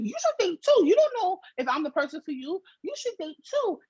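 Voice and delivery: high, mocking imitation of him; mocking his voice. Mocking the ex's excuse, high mocking voice. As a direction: parody him pitched high. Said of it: "He's like".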